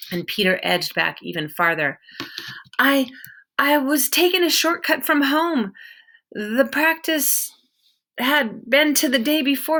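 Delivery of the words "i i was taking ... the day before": a lightly voiced boy, higher and hurried; a hint of a frightened kid backing off; nervous boy. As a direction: stammer an excuse to a scary stranger. Peter explains.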